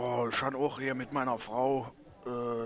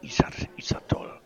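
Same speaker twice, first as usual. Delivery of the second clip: breathy after drinking; 9 dB louder than usual. Acted. Savoring beer after drinking.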